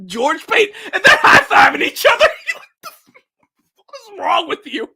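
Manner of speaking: high, squeaking through laughs; losing it while talking; hysterical laughter. Real, not acted.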